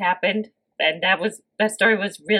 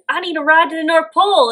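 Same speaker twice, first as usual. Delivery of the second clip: mock Santa; 8 semitones higher, 4 dB louder, faster than usual. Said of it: "And he says".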